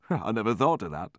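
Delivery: amused reply after a short laugh; hearty laugh. A narrator's reading. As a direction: speak through a light acted chuckle. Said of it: "He laughed very heartily at this".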